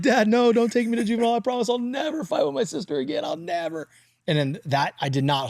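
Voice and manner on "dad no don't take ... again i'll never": mock pleading. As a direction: beg like a scared kid, then trail off. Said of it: "I'm like".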